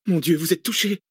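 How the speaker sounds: high, shocked exclamation; crying out. A narrator's reading. Cécile finding Arsène has been hit, crying out. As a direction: exclaim in alarm, pitch well up. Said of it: "s'écria Cécile".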